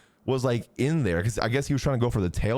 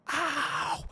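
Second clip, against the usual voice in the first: pained yelp; 13 semitones higher, 5 dB quieter than usual. Retold or imitated. Pain during massage, retold.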